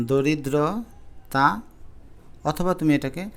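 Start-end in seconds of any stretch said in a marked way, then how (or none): 0.1-1.4 দরিদ্রতা said very slowly with a falling end; slow correct form. Shown as a demonstration. Teacher repeating the correct word দরিদ্রতা slowly for students. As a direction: draw out দরিদ্রতা syllable by syllable. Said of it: "দারিদ্রতা বলতে কোনো শব্দ নাই".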